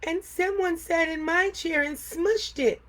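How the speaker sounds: put-on baby bear cries over his smushed chair; crying Baby Bear. A narrator's reading. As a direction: whine it like a small bear close to tears. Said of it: "cried Baby Bear".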